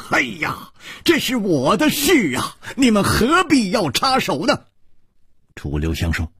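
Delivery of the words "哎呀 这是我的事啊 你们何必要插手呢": high, agitated protest; stamping exasperation. Acted. A swordsman tells friends to keep out, exasperated and high-pitched. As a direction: protest as if stamping your foot. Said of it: "他跺着脚说".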